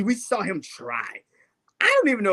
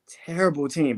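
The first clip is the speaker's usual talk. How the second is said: ordinary talk, nothing marked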